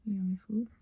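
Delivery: ordinary talk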